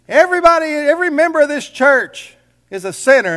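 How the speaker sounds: high, loud preacher yell; hollered out. Retold or imitated. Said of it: "he hollered out".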